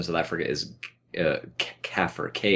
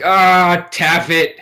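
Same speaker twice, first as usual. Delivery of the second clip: mock curse; 10 semitones higher, 10 dB louder, much slower than usual. Mock swearing in front of kid.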